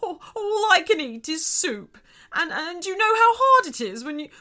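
hammed-up high, loud blubbering; crying. A narrator's reading. Shark sobs about living on soup, crying. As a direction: blubber through it, ending in a long boo-hoo. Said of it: "He was crying now".

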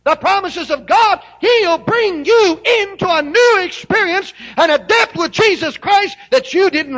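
loud, high fervent sermon; full-force preaching. Acted.

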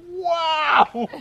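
loud, high, comic disbelief; hammed-up outraged astonishment; shocked. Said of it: "I was so shocked".

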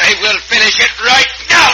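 loud, determined shout; loud, confident fighting shout. Acted. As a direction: shout as he lands the blow.

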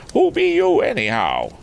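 high-pitched rustic demand; farmer's voice. Acted. Farmer repeating his challenge to a stranger, high rustic call. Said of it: "unmistakably the voice of a farmer".

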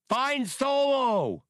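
very high, loud, stretched-out alien cry; a long piercing shriek of a command; yelled. Acted. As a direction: shout it as the pod launches. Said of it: "Spray yelled".

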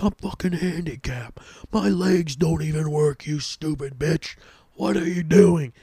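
high-pitched outraged yelling at a stranger; yelling at the woman who cut the bathroom line; mock furious rant. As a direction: flip out at her, voice up high. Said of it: "I flipped the fuck out".

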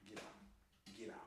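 hushed whispered get out. Retold or imitated. Shooing someone away while on the phone, urgent whisper. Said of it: "I'm like".

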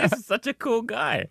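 high-pitched, amused gushing; mock admiration. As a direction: gush teasingly over how impressed you were. Retelling admiring thought, teasing.

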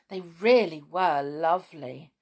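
ordinary delivery, nothing marked

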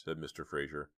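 ordinary delivery

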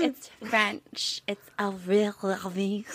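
softly whispered French "Avril Lavigne". Retold or imitated. Mimicking cousins, whispered French "[name]". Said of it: "They're like".

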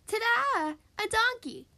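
high, loud showman's ta-da; big, high-pitched unveiling; excited reveal. A narrator's reading. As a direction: present it with a triumphant flourish. Fox unveils his donkey egg.

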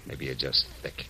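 soft, sighing, under the breath; a weary mutter to himself; sighing to himself, fed up with her; muttered aside. Acted.

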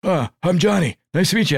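mock hearty greeting, lifted above your own voice; John Laurinaitis. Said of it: "John Laurinaitis came in and said".

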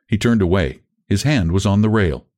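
usual speaking voice

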